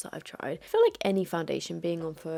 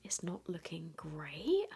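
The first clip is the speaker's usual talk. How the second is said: whisper; 3 semitones lower, 7 dB quieter, slower than usual. Real, not acted. Confiding about bad makeup.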